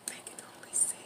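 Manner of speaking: very soft whisper; hushed, barely audible; whispered aside. Real, not acted. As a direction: whisper it as if confiding. Whispered reassurance to viewers.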